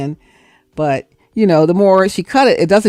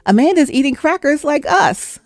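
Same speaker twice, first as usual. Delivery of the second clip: put-on little girl voice; 8 semitones higher, slower than usual. Niece showing doll eating.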